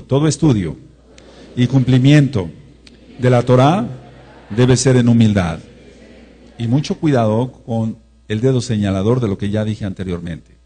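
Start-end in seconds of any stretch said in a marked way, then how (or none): 0.0-5.6 loud, phrase by phrase with pauses; feed each phrase out firmly for repeating; call and repeat. Shown as a demonstration. Preacher has congregation repeat after him, loud measured phrases. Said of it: "Repite conmigo".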